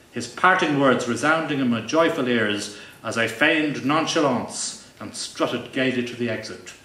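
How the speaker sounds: ordinary delivery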